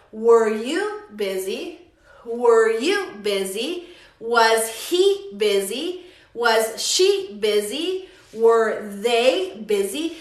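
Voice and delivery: slow, spaced words, rising at the end; slow model questions. Shown as a demonstration. Teacher models questions with busy, slowly and rising. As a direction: stretch each question out for learners.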